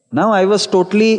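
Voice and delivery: ordinary delivery, nothing marked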